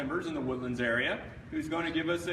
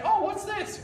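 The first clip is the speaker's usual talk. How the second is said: much higher mock-puzzled mom voice; puzzled parent; slower than usual. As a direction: lightly play a curious parent. Voicing parents finding the paper.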